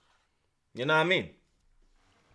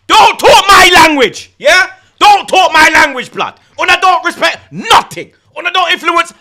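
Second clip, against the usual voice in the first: mock angry rant; 4 semitones higher, 4 dB louder than usual.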